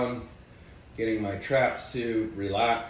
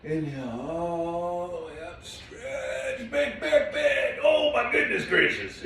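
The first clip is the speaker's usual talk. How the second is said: sing-song stretch; 6 semitones higher, slower than usual. Real, not acted. Big stretch, voice soars up.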